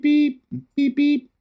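sing-song machine beeping; imitated monitor beep. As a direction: mimic the oxygen alarm going off. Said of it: "I'm still kind of beep".

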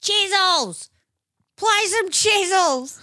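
high, loud heckling shout; drunk heckler yelling. Retold or imitated. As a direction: yell it up from the crowd like a pub heckler. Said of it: "he's going".

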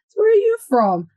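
high, loud retold question, quick; insistent questioning. Stranger pressing her about her origins, mock insistent question. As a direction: mimic someone pressing for an answer.

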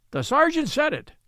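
high, eager outburst; excited cry. Acted. Old steward crying out that the sergeant predicted it, excited. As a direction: blurt it out in sudden excitement. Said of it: "cried Betteredge".

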